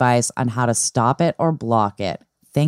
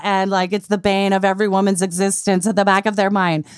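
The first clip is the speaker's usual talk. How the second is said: a mock angry outburst, pitched higher; over-the-top blunt rant; 6 semitones higher than usual. Telling a friend off about diet culture, a mock angry rant. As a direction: ham up a harsh, fed-up scolding. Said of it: "You don't have to be like".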